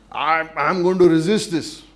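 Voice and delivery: loud, slow, defiant outcry; defiant cry. Acted. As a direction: shout it out, dragging it, refusing to give in. Jacob wrestling God, defiant.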